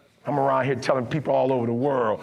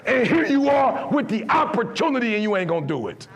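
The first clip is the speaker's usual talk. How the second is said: full preaching; 13 semitones higher than usual. Acted. Speaker preaching to a student about ancestors, impassioned.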